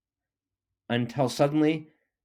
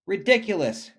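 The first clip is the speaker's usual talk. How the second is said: high, loud scoffing cry; indignant old tortoise crying out; cried out; 8 semitones higher, 5 dB louder than usual. A narrator's reading. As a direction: scoff at the very idea. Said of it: "cried Mister Tortoise".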